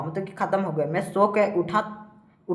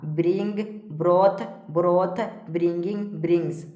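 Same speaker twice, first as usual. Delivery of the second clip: evenly spaced word-by-word list; steady classroom drill; verb drill recitation. Shown as a demonstration. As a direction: recite each form separately for pupils to memorise. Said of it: "इस प्रकार से सारा को पढ़ते जाना है".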